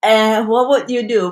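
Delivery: ordinary talk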